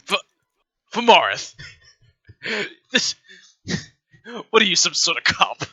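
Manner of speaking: a nervous crook sniffing out a cop; alarmed suspicion. Acted. Lizard-folk thug accusing a stranger of being a cop, high and loud.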